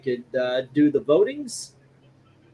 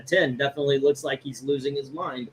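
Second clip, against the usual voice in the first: ordinary talk, nothing marked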